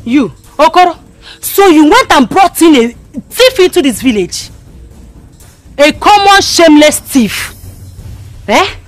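high, very loud tirade; furious accusation. Acted. Villager confronting Okoro for bringing in a thief, furious shout. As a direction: denounce him at full shout.

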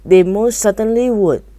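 storyteller's indignant protest; indignant cry. A narrator's reading.

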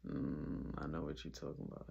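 deep, knowing murmur; low knowing voice. Retold or imitated. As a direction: drop way down, all-knowing. Knowing reply, voice dropped low.

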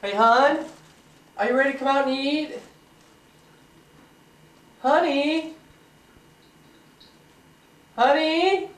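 loud, high call across the house. Real, not acted. Husband calling wife from the kitchen to breakfast, loud call. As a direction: call her to come eat.

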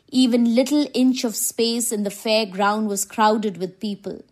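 plain reading voice

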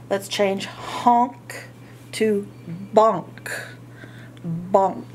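usual speaking voice

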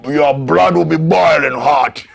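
normal talking voice

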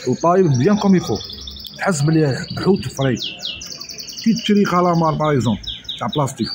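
usual speaking voice

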